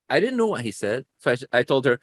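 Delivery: ordinary delivery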